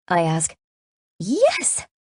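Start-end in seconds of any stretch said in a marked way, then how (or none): 1.2-1.9 high, soaring delighted yes; near squeal. A narrator's reading. Friend offered homemade panini, squealing. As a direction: squeal it with delight, pitch leaping up. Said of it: "she practically squeals".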